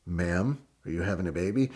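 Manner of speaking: low, soft, deadpan officer imitation; stoic officer. Officer questioning the wife in labor, stoic. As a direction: play the unmoved cop, keep it quiet and low. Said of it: "he's just stoic and he says".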